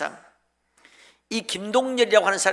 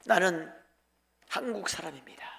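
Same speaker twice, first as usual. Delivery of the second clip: solemn quoted declaration; 2 semitones lower, 9 dB quieter than usual. Acted. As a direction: quote it quietly, with weight. Acted quote of missionary's moving speech.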